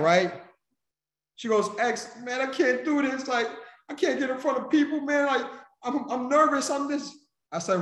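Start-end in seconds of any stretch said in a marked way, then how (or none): 1.8-7.1 high mock-tearful pleading voice, much higher; imitating crying friend. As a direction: play her upset and nervous on the phone. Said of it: "she's crying".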